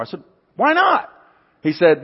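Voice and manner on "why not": high, squeaky disbelief; mock-astonished, much higher pitch; incredulous. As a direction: shoot the question up in shock. Retold disbelief at coworker.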